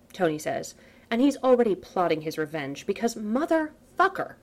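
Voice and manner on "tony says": usual speaking voice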